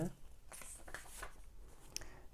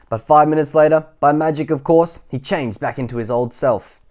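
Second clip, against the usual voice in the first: louder, animated storybook voice; energetic bedtime-story narration; reading to children; 5 semitones higher, 11 dB louder than usual. A narrator's reading. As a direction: tell it big for little listeners.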